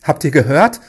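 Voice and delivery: loud, relieved exclamation; relieved shout. A narrator's reading. King to his men on hearing Daniel, relieved shout. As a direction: cry out with relief. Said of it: "rief der König erleichtert".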